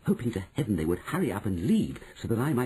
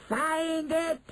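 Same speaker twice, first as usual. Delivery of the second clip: high, screeching witch command; screamed order. Acted. Grand High Witch orders the hunt, high scream. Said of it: "screamed the Grand High Witch".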